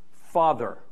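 ordinary talk

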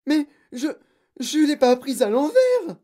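high, loud, halting protest; terrified pupil stammering; panicked stammer. A narrator's reading. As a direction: stumble into a desperate protest. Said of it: "bégaya Guillaume".

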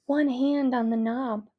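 ordinary delivery, nothing marked

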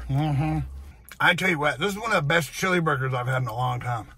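chewing while talking; words muffled by food; mouth full. Real, not acted. Seen 4: bites burger at 0.3 and 4.0, talks with mouth working.

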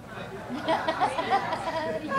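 high-pitched giggling laugh; soft squealing laugh. Real, not acted. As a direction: let a delighted high laugh out. Punchline of his story.

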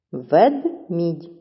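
"Ведмідь" with a gap after Вед; teacher dividing "Ведмідь" into two separate syllables; split into syllables. Shown as a demonstration. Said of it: "чи правильно поділили".

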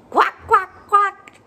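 high, loud quacks like a duck; quacking duck. Acted. Storyteller showing toddlers a duck, loud high quacking. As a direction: quack it out brightly for the little ones. Duck sound in kids' book.

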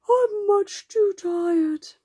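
put-on high, loud, drawn-out child's whine; whining tired child. A narrator's reading. Katy voiced, too tired for sightseeing.

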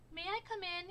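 high, bright call into the burrow; Groundhog calling. A narrator's reading. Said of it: "he called".

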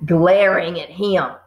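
plain reading voice

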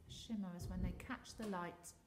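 ordinary delivery, nothing marked

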